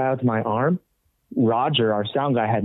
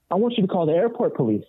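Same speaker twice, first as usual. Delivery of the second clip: indignant demand; 8 semitones higher than usual. Retold or imitated.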